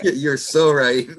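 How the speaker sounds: slow, laughing through the words; real laughter, teasing agreement. Real, not acted. Laughing at friend's admission.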